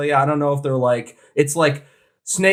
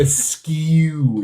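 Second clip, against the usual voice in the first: drawn-out, relished imitation; savouring the word. Teasing the co-host's love of the word, savouring it. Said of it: "you just, like, eat it".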